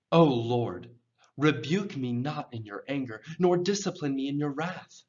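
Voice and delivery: measured, meaningful plea with pauses after the address; expressive pleading reading. Shown as a demonstration. Teacher shows learners meaning through tone and rhythm, expressive pleading. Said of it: "communicating the meaning through the tone".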